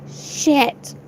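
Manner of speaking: ordinary talk, nothing marked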